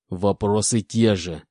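slow, menacing hiss; hissed in anger. Acted. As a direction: hiss it through cold fury. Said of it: "злобно прошипел он".

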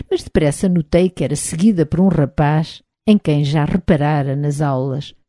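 usual speaking voice

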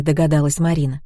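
ordinary delivery

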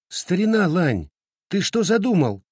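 high-pitched startled cry; alarmed exclamation. A narrator's reading. Girl calls to friend heading for the kitchen, exclaiming. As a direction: call out, demanding to know his plan. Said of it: "воскликнула Фэн Хуан".

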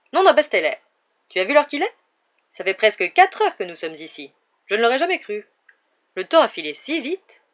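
jovial showman. A narrator's reading. Lockhart jovial, loud.

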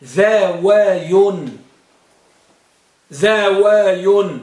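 ذاواي drawn out slowly, syllable by syllable; slow pronunciation demo. Shown as a demonstration. Teacher models Sibawayh's form ذاواي slowly for the class. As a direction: stretch ذاواي so the students catch every sound. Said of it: "سيبويه قال نقول فيها ماذا؟".

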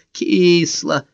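put-on high, whiny childish grumble; hammed-up whimpering complaint; plaintive complaint. A narrator's reading. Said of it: "скорчил жалобную рожу и пожаловался".

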